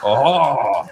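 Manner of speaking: drawn-out tripping murmurs through laughter; mock opera-like sounds. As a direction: imitate the stoned group moaning like an opera, laughing. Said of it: "we're like a fucking opera".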